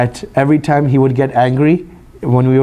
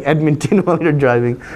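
laughing. Real, not acted. Jokes about driving off angry.

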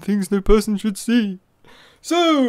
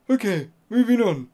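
ordinary talk, nothing marked

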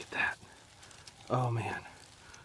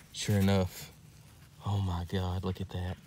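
normal talking voice

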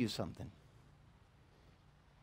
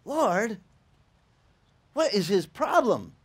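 exasperated prayer; 4 semitones higher, 4 dB louder, slower than usual. Retold or imitated.

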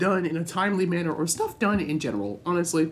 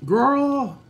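dismayed exclaim; 10 semitones higher, 6 dB louder than usual. Real, not acted. Gamer reacting to his poisoned companion, high dismayed cry. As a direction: cry out in disbelief.

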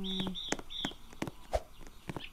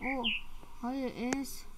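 normal talking voice

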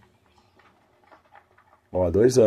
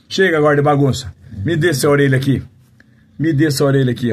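loud, higher playful scolding of the dog; 7 semitones higher, 8 dB louder, faster than usual. Real, not acted. Owner scolding his playful puppy, loud and high. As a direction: tell off a rowdy puppy, loud.

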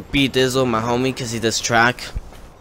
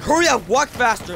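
high-pitched, urgent ordering; impatient guard; 11 semitones higher than usual. Retold or imitated. Security guard rushing a kid out of the gym, impatient. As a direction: hurry someone along in a raised pitch.

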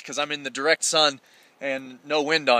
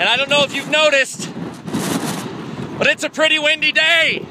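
shouting over wind; 8 semitones higher than usual. Real, not acted. Windy ridgeline.

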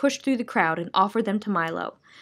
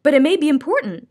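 insisting; 6 semitones higher, 6 dB louder, faster than usual. Acted. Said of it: "insisted Milo".

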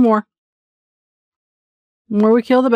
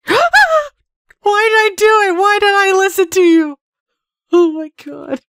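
high, louder panicked wail; 11 semitones higher, 3 dB louder than usual. Real, not acted. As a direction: cry out in real panic after a scare. Panics after a jump scare.

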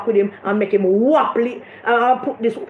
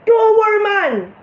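high, slowed mocking sing-song; mock Bunting again; 7 semitones higher, slower than usual. Mocking Bunting's promise to Jimmy, high and slow. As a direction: squeeze out the line in a mocking high voice. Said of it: "Bunting tell him".

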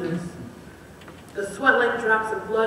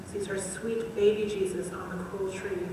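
usual speaking voice

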